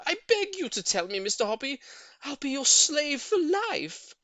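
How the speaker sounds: very high, loud begging; desperate plea. A narrator's reading. Old lady begging neighbour for the secret, high loud plea. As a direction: throw yourself on his mercy. Mrs Silver pleads.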